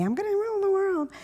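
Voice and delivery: high, fast squeaky cartoon voice; Minnie Mouse voice. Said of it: "that Minnie Mouse voice".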